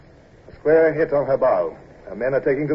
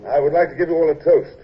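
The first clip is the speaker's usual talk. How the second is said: higher, louder speech voice; formal toast; 3 semitones higher, 4 dB louder than usual. Acted. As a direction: raise a glass and speak out.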